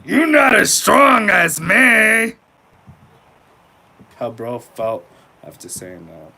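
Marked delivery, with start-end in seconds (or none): 0.1-2.3 slow, high, shouted boast, stretched out; mock shout. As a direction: yell it like a puffed-up tough guy. Mocking reenactment of boast.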